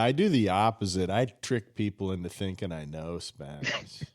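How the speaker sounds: ordinary talk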